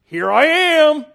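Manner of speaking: loud, high, slow announcement; mock grand reveal; triumphant declaration. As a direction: proclaim it to Pilate. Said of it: "Gone back to Pilate and said".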